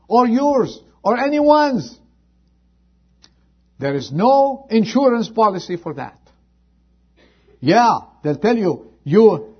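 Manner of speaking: high, loud scripture call; fiery revival preaching; preaching at full force. Acted. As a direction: thunder it from the pulpit. Preacher climbs in urgency.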